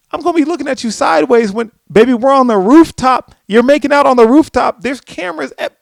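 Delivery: high, loud mock alarm; exasperated scolding. Telling baby they're making out on a rooftop, alarmed. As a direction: shout it in hammed-up disbelief. Acted scolding of careless lovers.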